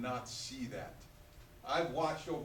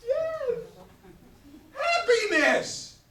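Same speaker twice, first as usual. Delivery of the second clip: much higher and louder, drawn out; triumphant cheer; 16 dB louder, much slower than usual. Real, not acted. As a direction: celebrate the right answer. Teacher cheers the class answer.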